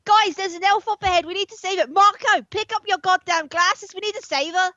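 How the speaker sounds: put-on high, urgent, wobbly Australian accent; badly faked Australian accent, calling out urgently; attempted Australian accent. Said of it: "work into the voice".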